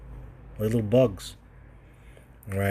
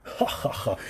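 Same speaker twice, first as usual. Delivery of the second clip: mock laugh. God laughing in voiced quote.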